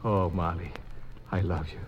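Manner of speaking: low, soft declaration of love; tender love. Acted. Young man tells his new fiancée he loves her, softly. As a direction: murmur it close to her.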